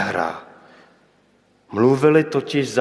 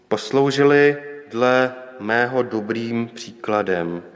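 usual speaking voice

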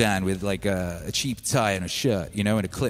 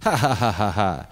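imitated scornful laughter, ha-ha-ha; staged belly laugh at someone's plan; mocking boss laugh. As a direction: laugh at him like a dismissive boss. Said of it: "he laughed at me".

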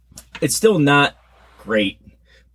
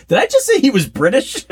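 much higher, caught-out disbelief; shocked at himself. Retold or imitated. Host replaying his own blunder, high and incredulous. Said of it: "and I'm like".

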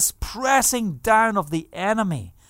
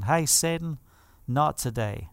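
quiet defiance; 5 semitones lower, 6 dB quieter than usual. Shown as a demonstration. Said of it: "we just want to make a declaration".

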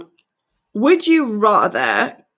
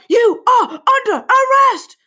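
high, slow barking cop order; mock police shout; slower than usual. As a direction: yell it like an arresting officer. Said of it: "you could be like".

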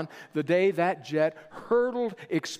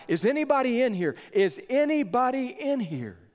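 calling out; 7 semitones higher than usual. Retold or imitated. Rescuer in smoky building calling for survivors, high urgent call. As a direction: shout into the dark building for survivors. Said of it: "he began to cry out".

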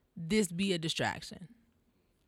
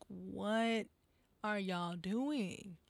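slow and quiet; 7 dB quieter, much slower than usual. Real, not acted. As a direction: let it sink in slowly and softly. Disbelief at the crowd.

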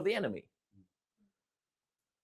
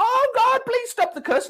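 whiny plea pitched far higher; mock crying plea. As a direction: parody a wailing believer. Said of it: "start crying".

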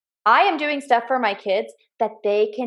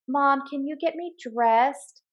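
put-on a slow, small-kid plea, softer; lightly voiced whiny son; 3 semitones higher, 5 dB quieter, slower than usual. Mom imitating her son asking to get dressed, lightly whiny. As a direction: ask like a little boy who wants help.

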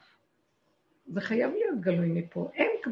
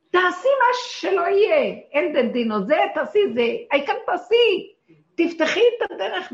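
loud urging; 10 semitones higher, 9 dB louder than usual. Retold or imitated. Telling an undecided woman to act, loud insistence. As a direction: drive her to act at full voice.

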